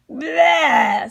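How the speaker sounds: loud grossed-out bleh; disgusted bleh. Acted. Andrew answers his mom with a loud disgusted bleh. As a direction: spit out the disgust. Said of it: "said Andrew".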